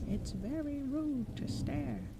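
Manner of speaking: high, slow, huffy knocker voice; a stuffy old door knocker, offended; prim door knocker. Acted. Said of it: "said the first knocker".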